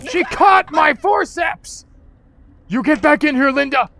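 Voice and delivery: loud high yell at Linda; shouting. Real, not acted. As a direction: yell it out in outrage. Yelling at game character.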